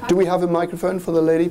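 normal talking voice

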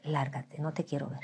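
low, soft send-off; retold quiet dismissal. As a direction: dismiss him under your voice. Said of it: "Así dije".

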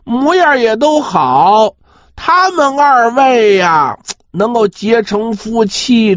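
usual speaking voice

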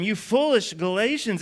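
high, slow scolding; lightly acted yell of reproach; mild mock yell. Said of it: "Paul yells at them".

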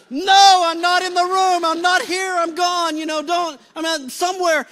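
high, loud mock-frantic protest; frantic refusal. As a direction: cry out to get out of the call. Retold refusal to take the phone.

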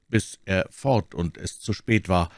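ordinary delivery, nothing marked

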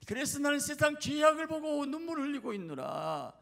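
high, loud, sustained preacher's cry; impassioned divine lament. Acted. Jesus grieves over the sins of the world, crying lament. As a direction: wail out the declaration at full force.

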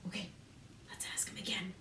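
softly whispered praise. Acted. Teacher hushes the class while sneaking up, whispering. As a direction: keep it hushed so the wolf won't hear.

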